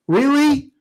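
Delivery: can't believe what he just read; incredulous. Real, not acted. Disbelief at a fan's dumb idea.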